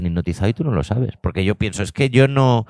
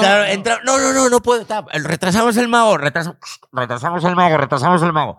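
panicked rush; 11 semitones higher, 3 dB louder than usual. Retold or imitated. Panicked crew, countdown retold.

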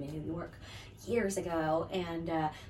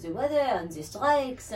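slow mock French accent, drawn out; a playful fake French accent turning her away; bad French accent; 3 semitones higher, slower than usual. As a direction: imitate a Paris local in a hammed-up French accent. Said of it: "My bad French accent, here it is".

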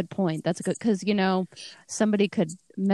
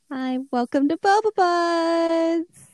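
high, slow show welcome; podcast intro voice; 10 semitones higher, slower than usual. Acted. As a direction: stretch out the show name.

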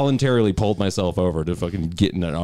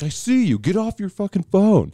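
angry cop; 13 semitones higher than usual. Retold or imitated. Retelling the cop's order to the driver, mock angry. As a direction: bark it like a furious cop.

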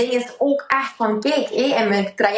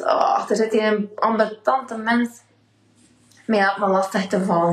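normal talking voice

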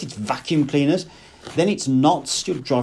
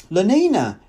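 apprehensive; 7 semitones higher, 5 dB louder than usual. A narrator's reading. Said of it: "he repeated apprehensively".

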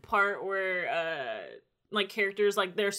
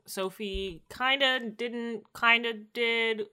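slow, drawn-out hedge; weighing it word by word; hedging; 2 semitones higher, much slower than usual. Real, not acted. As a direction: stretch each "kinda" doubtfully. Hedging over what really happened.

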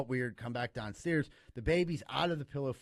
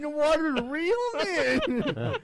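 high, loud laughing punchline; 12 semitones higher, 9 dB louder than usual. Real, not acted. Laughing loudly at own joke about the baby. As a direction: crack up while you say it.

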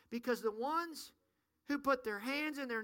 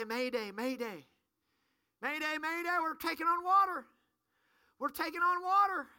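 urgent shouted distress call; mayday radio call; 7 semitones higher, 6 dB louder than usual. Pretend. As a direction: yell mayday, panicked and loud. Calling mayday on sinking boat.

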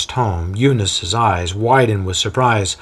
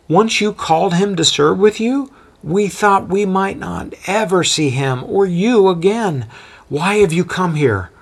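lightly voiced anxious woman, higher; worried mother; 9 semitones higher than usual. Mother pressing Paul about her son, lightly voiced worry.

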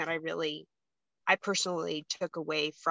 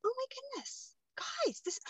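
mock teary gasp; 12 dB quieter than usual. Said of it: "And then I was like".

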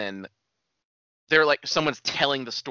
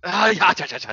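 mock nonsense chant; 6 semitones higher, 5 dB louder than usual. Mock Irish-sounding syllables.

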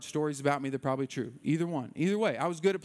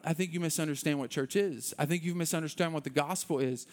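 ordinary delivery

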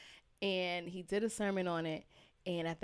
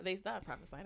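ordinary talk

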